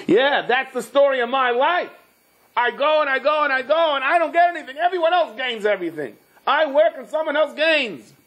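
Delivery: high-pitched acted complaint; exasperated rant. Unlucky man complains about his life to the maiden, exasperated high rant.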